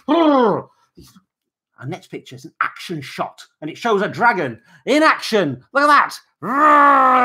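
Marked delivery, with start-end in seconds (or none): 0.1-0.7 high playful roar; mock roar. 6.4-7.2 loud high roar; dragon roar. Pretend.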